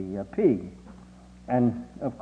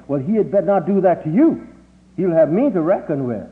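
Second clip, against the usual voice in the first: protective mother; 6 semitones higher, 7 dB louder than usual. Retold or imitated.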